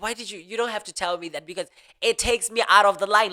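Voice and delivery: retold irritated protest; snapping back. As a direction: push back fast and a bit loud. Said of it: "I'll snap back at them".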